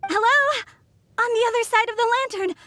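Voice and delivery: high, questioning call. Acted. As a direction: call out to someone who may be listening. Calling through lantern to far side.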